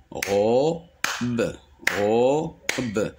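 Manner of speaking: "robe" split into ro, pause, be; syllable by syllable. Shown as a demonstration. Teacher demonstrating two syllables, syllable by syllable. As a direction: separate "robe" into two sounded beats. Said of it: "Le mot robe contient deux syllabes".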